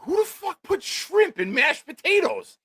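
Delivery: high, drawn-out outraged yell; furious. Retold or imitated. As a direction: explode in slow, high-pitched fury. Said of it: "Now I'm furious".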